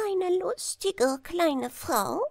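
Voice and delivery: a little chancellor's piping voice; high falsetto. Acted. Chancellor proposing marriage to the king, high falsetto. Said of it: "mit seiner hohen Fistelstimme".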